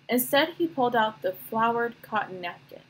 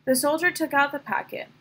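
ordinary delivery, nothing marked

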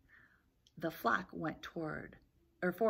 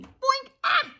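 high, loud "boink" and startled squawk; sudden cartoon bonk, then a sharp yelp; 10 dB louder than usual. Acorn hits the chick on the head, startled squawk. Collision with Henny Penny.

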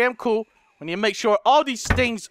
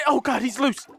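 ordinary delivery

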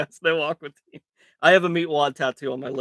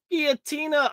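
excited call; 9 semitones higher than usual. Real, not acted. Calls out the hit.

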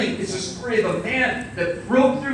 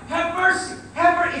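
high-pitched cry, only mildly yelled; imitated yelling; 8 semitones higher than usual. Blind man yells out to Jesus, imitated cry. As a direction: call out high, imitating a plea. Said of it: "he's just yelling out".

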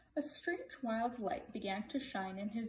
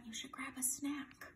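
playful whispered aside; 3 semitones higher, 8 dB quieter than usual. Real, not acted. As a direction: whisper it like a secret. Aside to children, unvoiced.

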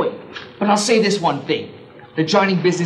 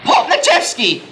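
demo yell; 7 semitones higher, 3 dB louder than usual. Shown as a demonstration. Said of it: "yell at the top of your lungs".

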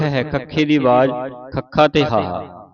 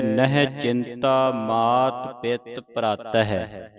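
slow, careful scripture recitation; slow, soft model reading of the verse; 2 semitones lower, 6 dB quieter, much slower than usual. Shown as a demonstration. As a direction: recite it slowly, word by word. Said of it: "ਇੱਥੇ ਵਿਸਰਾਮ ਹੈ".

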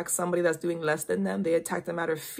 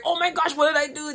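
higher, lightly panicked quote; mild mock panic; 9 semitones higher, 4 dB louder than usual. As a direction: play a touch of panic, pitched up. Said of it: "If you have to write".

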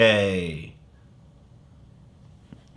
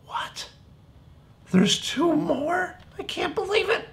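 very high, soft mock disbelief; mock surprise; 6 dB quieter than usual. Teacher pretending amazement for the kids, very high. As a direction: squeak with pretend shock.

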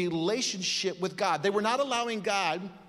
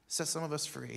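quieter, fading repetition; softer echo; 3 semitones lower, 7 dB quieter than usual. Real, not acted. Pastor repeating the prayer softly, quieter plea. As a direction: let the plea fall away.

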